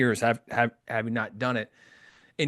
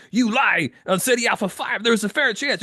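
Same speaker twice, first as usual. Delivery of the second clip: higher, hammed-up Chekhov protest; Chekhov impression; 9 semitones higher than usual. Host acting out Chekhov's protest to Khan, high and hammy. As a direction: play Chekhov outraged, big and broad. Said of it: "Chekhov has a very distinctive accent".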